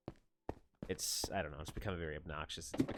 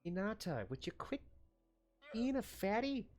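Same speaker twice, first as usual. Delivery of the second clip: high, teasing pet voice; pet talk; 14 semitones higher than usual. Acted. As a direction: coo at the pet, much higher. Teasing his pet guinea pig.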